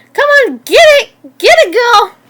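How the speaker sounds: high, loud goading shouts; screaming. A narrator's reading. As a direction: yell to whip a fighting animal up. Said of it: "they screamed".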